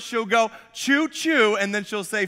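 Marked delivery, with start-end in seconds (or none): 0.7-1.6 lightly mimicked little daughter, higher pitch; a higher, playful quote of a kid; imitating daughter. Dad quoting his daughter's catchphrase, playful imitation. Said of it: "She'll say these two words".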